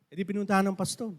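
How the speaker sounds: ordinary talk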